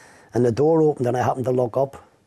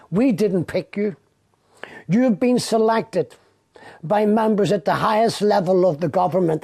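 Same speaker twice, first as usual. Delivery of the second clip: high, slow, taunting threat; menacing detective; 7 semitones higher than usual. Retold or imitated. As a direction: spell it out slowly, sneering.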